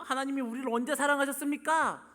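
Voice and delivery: loud, high, accusing question; confrontational challenge. Acted. Israelites confronting God about his love, accusing. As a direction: demand an answer as the aggrieved people. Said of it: "따지듯이 얘기합니다".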